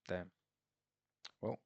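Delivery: ordinary delivery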